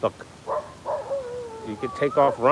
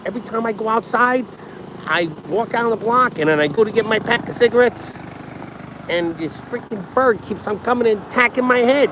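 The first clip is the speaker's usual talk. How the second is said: imitating friend; 7 semitones higher than usual. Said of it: "He's like".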